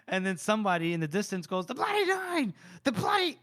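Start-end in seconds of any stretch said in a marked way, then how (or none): 1.7-3.4 high frantic shout of the name; panicked battlefield cry; distant yell. Retold or imitated. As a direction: scream it like a terrified soldier far off. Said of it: "screaming bloody murder".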